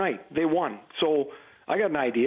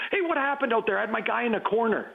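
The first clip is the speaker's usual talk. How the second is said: mock yell; 7 semitones higher than usual. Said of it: "He'd yell down to the forwards".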